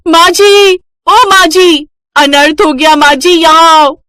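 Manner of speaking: high, loud call across the house. Acted. Daughter-in-law shouting for mother-in-law from kitchen, loud alarmed call. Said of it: "माला की जोरदार आवाज से पूरा घर गूंज जाता है".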